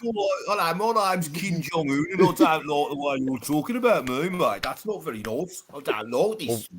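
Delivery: put-on a light Birmingham accent, playful, as Kim Jong-un; mock Brummy accent. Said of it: "That's not talking with a Brummy accent".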